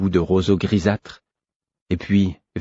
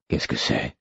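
put-on tired old prince; 8 semitones lower, 8 dB quieter than usual. Vérité voiced, old weary prince.

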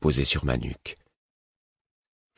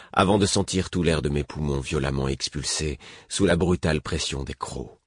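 ordinary delivery

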